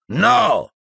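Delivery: one high, forceful cry; roared command. A narrator's reading. Piton stopping the torture of Harry, roaring. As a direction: roar it to halt everything. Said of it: "ruggì la voce di Piton".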